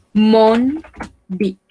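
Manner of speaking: "mon", a pause, then "bic"; word by word. Shown as a demonstration. Teacher models French for learners, word by word.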